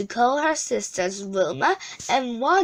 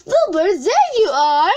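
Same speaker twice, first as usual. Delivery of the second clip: relieved call; 7 semitones higher, 6 dB louder than usual. A narrator's reading. Finding the cat.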